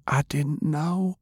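put-on high, slow little girl's voice; young girl, hushed. A narrator's reading.

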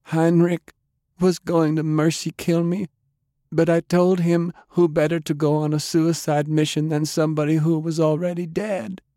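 high-pitched woman's voice, sorrowful; dying woman, pleading. A narrator's reading. Undead Delilah asking Jake to let go, pleading. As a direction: plead gently in a high female register.

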